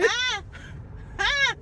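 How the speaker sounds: sharp high taunting laugh; mocking laugh. Real, not acted. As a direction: cackle at her loss. Mocking the losing fan.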